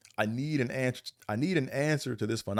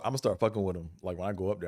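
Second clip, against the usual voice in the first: usual speaking voice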